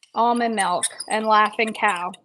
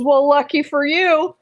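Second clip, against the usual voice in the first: cowboy sidekick, pitched higher; higher-voiced playful cowboy reply; Billy Bob; 5 semitones higher, 4 dB louder than usual. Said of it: "Billy Bob ... pulls out a wedge of laughing cow and says".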